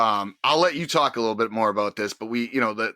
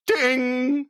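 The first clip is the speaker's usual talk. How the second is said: high, far-off sung ding like a ring bell; mock bell ding; 14 semitones higher than usual. Host imitating Heyman's ten bell salute: high mock ding. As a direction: chime it out like the ten bells. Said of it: "He's like".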